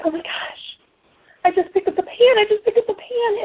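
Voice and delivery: high, stunned disbelief, repeating it; gasping it out in astonished wonder; amazed. Retold or imitated. Realizing she lifted the pan, amazed and high-pitched. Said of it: "And I'm like".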